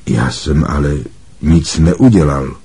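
mild protest, low and subdued; Harry puzzled. A narrator's reading. Harry questioning his points, mildly puzzled. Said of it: "podivil se Harry".

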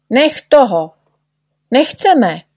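the others shout at Neználek to stop; shouting in protest. A narrator's reading. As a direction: shout him down, fed up. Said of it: "křičeli ostatní".

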